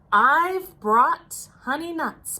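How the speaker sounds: a friendly badger offering his nuts; grinning badger. A narrator's reading. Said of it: "badger says with a grin".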